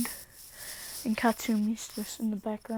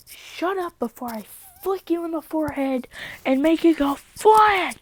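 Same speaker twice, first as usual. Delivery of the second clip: higher, louder mock threat, slowing at the end; a hammed-up furious yell; angry shout; 7 semitones higher, 10 dB louder than usual. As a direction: yell the threat through the door. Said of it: "He's like".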